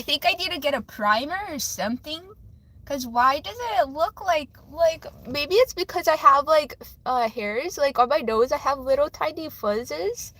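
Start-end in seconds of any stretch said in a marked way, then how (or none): none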